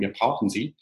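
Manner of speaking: ordinary talk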